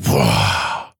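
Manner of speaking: deep booming mock roar of power; big forceful roar. God not in the storm, a low booming roar. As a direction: let out a huge low blast. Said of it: "instead of being this big, forceful".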